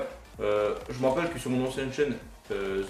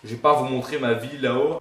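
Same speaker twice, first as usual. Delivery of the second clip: ordinary delivery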